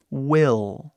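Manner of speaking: will with a dark final L; English will. Shown as a demonstration. Teacher showing the final L in will. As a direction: close will on a held L. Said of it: "Ele tem esse som de L".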